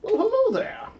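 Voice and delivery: put-on high, quavering old scholar; old sage voice. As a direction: greet them like a wise old man. Greeting in Cain voice.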